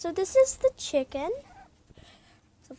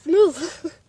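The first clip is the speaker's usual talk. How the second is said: sharp command; 8 dB louder than usual. Real, not acted. Ordering the dog away.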